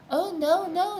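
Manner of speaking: high, firm doubled no; insisting twins. A narrator's reading. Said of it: "they insisted".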